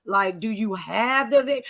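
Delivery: slow, pointed questions; host presses guest slowly for proof; slow grilling. Retold or imitated.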